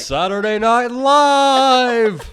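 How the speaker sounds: loud, high, drawn-out announcer shout; big hammed-up live TV intro; show announcer. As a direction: belt out the show opening, stretching it long. Mimics TV show announcer opening.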